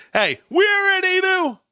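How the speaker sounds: squeaky high sarcastic retort; mocking high voice. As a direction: toss it off in a high mock voice.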